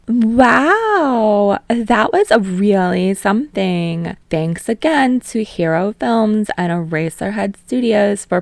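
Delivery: loud, high, bright host voice working the crowd; perky host pumping up a live crowd; bubbly talk show host. Acted. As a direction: gush at the audience like a TV host. Talk show host hyping audience.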